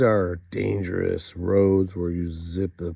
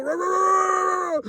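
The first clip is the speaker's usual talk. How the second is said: animal roar. Retold or imitated. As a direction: roar like a wild animal to scare a friend. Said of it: "I'm like".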